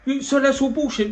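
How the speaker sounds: high, exasperated mock complaint; exasperated mate. Imitating a fed-up mate moaning at him. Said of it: "He went".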